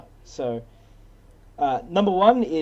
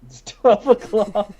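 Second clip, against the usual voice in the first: slow, louder, higher hammed cowboy call; mock cowboy drawl; 4 semitones higher, 6 dB louder, slower than usual. Friend repeats the parody line as a cowboy, slow and loud. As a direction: announce the time like a showdown, slow.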